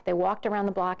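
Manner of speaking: ordinary talk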